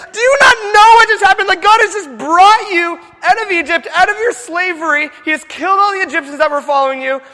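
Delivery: loud, high-pitched furious tirade; angry rant. Retold or imitated. Moses scolding the people over the golden calf, shouting angrily. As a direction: lay into them in shouted disbelief. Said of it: "he was so mad".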